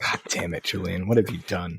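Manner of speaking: low, soft mock despair. Co-host mock-blaming Julian for the Texas talk, low and soft. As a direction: grumble it in fake dismay.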